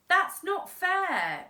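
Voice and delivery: put-on boy protesting loudly to crime boss. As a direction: cry out it's not fair. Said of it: "protested Frank".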